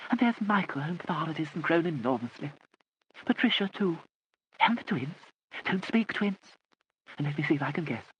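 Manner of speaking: put-on high, mild elderly lady; vague old aunt. A narrator's reading. Lady Catherine chattering to the family, high and vague. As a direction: play the dotty aunt.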